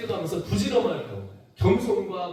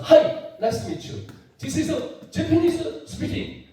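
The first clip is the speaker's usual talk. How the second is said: slow, breathy mock Japanese English; Japanese accent; 8 semitones higher, much slower than usual. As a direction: imitate a Japanese speaker's English. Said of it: "일본 사람 나와서". (느린 일본식 영어 흉내)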